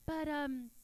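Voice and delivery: normal talking voice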